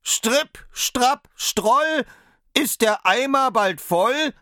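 tiny high voice calling from inside the cow; high little voice, calling slowly; Daumerling calling out. A narrator's reading. Tiny tailor calls out from the dark cow. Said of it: "da rief er".

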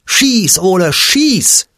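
high, loud urging shout; loud, high, slow cheering yell; crowd yelling. A narrator's reading. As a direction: roar it from the sidelines. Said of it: "die Rottendorfer schreien".

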